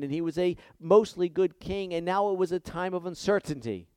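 high, loud, fervent preaching voice; preaching at full force. Acted. Pastor preaching the vision to his church, loud and fervent. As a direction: proclaim the vision to the congregation.

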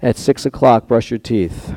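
mock voice of God. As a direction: intone it like God dictating a schedule. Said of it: "we wanna hear every morning".

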